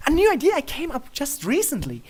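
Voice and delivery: high, bright-eyed pitch of a new idea; eager high pony voice; excited princess. Acted. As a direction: sell it like a giddy princess. Luna excitedly pitching her idea.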